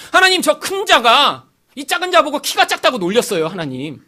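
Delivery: put-on high, childlike telling on someone to God; tattling angel. Guardian angel reporting the bully to God, eager tattle. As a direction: run to God and tell on the bully. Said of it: "하나님한테 가서 다 아뢰고 오는 거예요".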